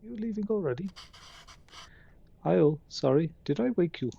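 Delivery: tentative put-on Indian voice; Indian accent. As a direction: attempt an Indian accent, not very well. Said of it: "I'm going to do my Indian voice".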